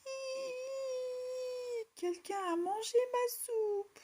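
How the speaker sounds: very high, whimpering cub voice; a tiny bear sobbing over his empty bowl; little bear crying. A narrator's reading. Little bear crying that his soup was eaten, high whimper. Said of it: "se mit à pleurer".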